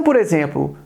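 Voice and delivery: ordinary talk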